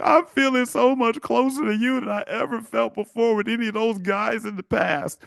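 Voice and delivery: high-pitched imitation of a gushing woman; hammed-up confession in a high put-on voice; mock tearful girlfriend. Woman confides in new boyfriend after pillow talk, mock tearful. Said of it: "she's tearing up, and she's like".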